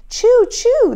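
usual speaking voice

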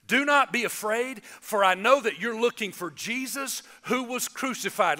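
normal talking voice

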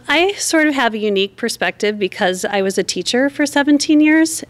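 ordinary talk, nothing marked

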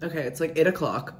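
ordinary delivery

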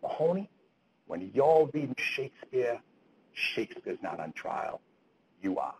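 slow, thick Boston accent, imitated; Father Kennedy. Priest rebuking the class clown Mahoney, in an imitated thick Boston accent. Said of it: "with his thick Boston accent".

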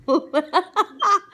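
high, loud burst of laughter; real belly laugh breaking in; cracking up. Real, not acted. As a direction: laugh helplessly. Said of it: "I can't believe I'm cracking up".

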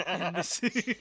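laughing. Real, not acted. Laughs through line.

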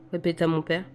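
usual speaking voice

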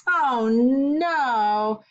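ordinary delivery

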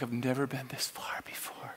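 low, hushed, private murmur; whispered aside. Acted. Reaching the front of the line, whispered aside. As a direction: say it under your breath.

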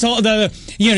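normal talking voice

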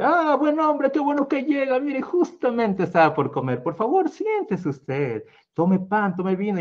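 high, cheerful host voice; lightly voiced happy host; glad welcome. A narrator's reading. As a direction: welcome the stranger with joy. Simha welcomes the guest gladly.

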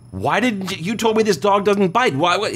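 high-pitched, baffled protest from a bitten man; indignant disbelief. Acted. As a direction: act out an outraged complaint in a joke, pitched well up. Acted bitten man in retold joke.

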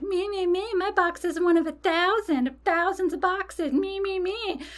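high-pitched sing-song mockery; childish mocking. Upset customer mimicking the phone agent, mocking. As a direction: parrot them back in a squeaky, bratty voice. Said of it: "I mock people when I'm upset".

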